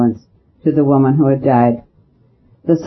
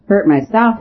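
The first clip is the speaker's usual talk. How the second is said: scoffing, pitched up high; contemptuous exclamation; 6 semitones higher than usual. A narrator's reading. The captain scoffing at the priest, contemptuous and high. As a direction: throw it back with scorn. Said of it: "exclaimed the captain with contempt".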